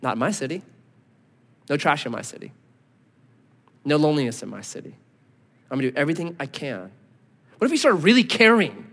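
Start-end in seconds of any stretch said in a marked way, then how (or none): 0.0-6.9 quiet resolve. Retold or imitated. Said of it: "or we can say".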